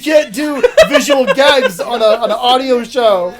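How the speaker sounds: laughing through the words; real laughter mid-sentence. Real, not acted. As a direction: crack up while talking. Laughing while talking.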